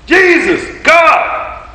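calling Jesus and God out high and loud; lifted shout of praise; called out. Acted. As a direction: ring the names out to the hall. Calling out divine names.

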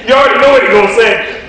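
talking through a laugh; can't hold the laugh back. Real, not acted. Laughing at the coach.

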